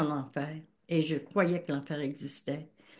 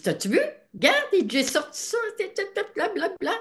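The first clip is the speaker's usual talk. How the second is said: excited boasting; 13 semitones higher, 3 dB louder than usual. Retold or imitated. Husband showing off stolen device.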